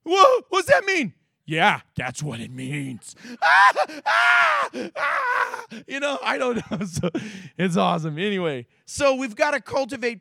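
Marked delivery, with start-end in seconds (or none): 0.0-1.1 high, loud alarmed question; mock startled. Voicing startled listener. 1.5-3.1 deadpan reply. Retold or imitated. Voicing grim reply. 3.4-5.7 high shrill comic scream; mock panicked shriek.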